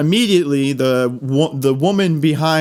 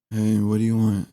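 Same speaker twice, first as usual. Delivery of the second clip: flat, lower bored cashier voice; depressed cashier; 4 semitones lower, 3 dB quieter than usual. Retold or imitated. Cashier to a customer, flat and bored. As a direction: mumble it like a joyless cashier.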